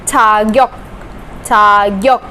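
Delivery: छाग्योक said clearly as "chagyok"; Korean word said slowly. Shown as a demonstration. Teacher modelling a Korean word, clear and careful. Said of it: "जैसे कि".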